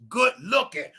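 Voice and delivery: ordinary talk, nothing marked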